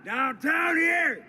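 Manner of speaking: high, slow street yell; shout. Real, not acted. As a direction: bellow it down the street. Yelling out on the street.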